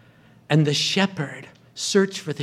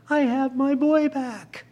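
overjoyed father; 11 semitones higher than usual. Acted. Father overjoyed at son's return.

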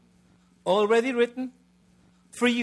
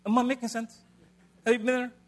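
ordinary delivery

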